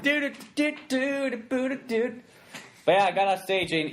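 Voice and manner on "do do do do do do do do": high sung ringtone tune. Retold or imitated. Teasing about the ringtone, high sung tune.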